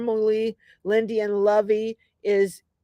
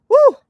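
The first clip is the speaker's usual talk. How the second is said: a loud, high whoop of delight; excited woo; 12 dB louder than usual. Real, not acted. Cheering for the food she had in the Bahamas.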